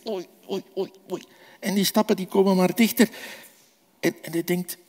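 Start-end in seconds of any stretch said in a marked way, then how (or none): 0.1-1.2 soft, anxious little oei oei; worried oei. Acted. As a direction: mutter it softly like a frightened boy. (zacht en bang gemompeld)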